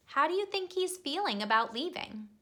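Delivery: ordinary delivery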